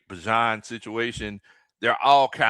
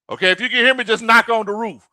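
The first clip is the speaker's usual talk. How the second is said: loud, raised playful call; 9 semitones higher, 7 dB louder, faster than usual. Pretend. Host to Silk on the roof, calling loudly. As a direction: project up to someone on a roof.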